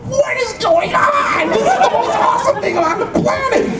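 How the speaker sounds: hammed-up high-pitched freak-out; excited fan shout. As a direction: shriek it like a starstruck fan, hammed up. Retold amazement at seeing costumed fan.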